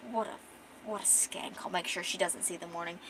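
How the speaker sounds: quiet, seething acted contempt; hushed, mildly venomous queen; jealous queen. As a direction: mutter the threat under your voice. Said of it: "Queen is not happy about this".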